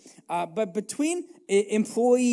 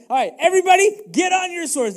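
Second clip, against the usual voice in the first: much higher, louder battle call; David shouting his men to arms; rallying shout; 11 semitones higher, 8 dB louder than usual. Acted. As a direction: rouse the whole troop.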